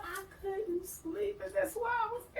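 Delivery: high mock-tearful wail, growing loud; desperate wailing excuse. Defending herself to the angry doctor, tearful wail. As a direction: plead helplessly, high and building.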